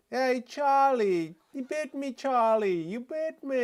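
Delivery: put-on high, drawn-out whine of a British child; British kid. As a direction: wail it slowly like a little British boy. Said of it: "I think it's a British kid though".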